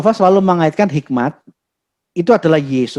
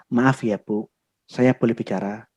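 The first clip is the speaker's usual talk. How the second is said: soft, humble request, much softer; gentle and soft; 7 semitones lower, 7 dB quieter than usual. Retold or imitated. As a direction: ask permission meekly, like a servant before nobility. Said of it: "Dan saya ngomongnya lembut, pelan".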